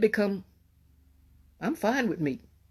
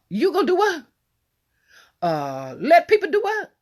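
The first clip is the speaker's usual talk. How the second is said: incredulous; 9 semitones higher than usual. Acted. Incredulous question to congregation.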